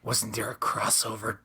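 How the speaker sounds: unvoiced whisper. Real, not acted. Friend whispering about a crossover movie, hushed. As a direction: keep it hushed and secret.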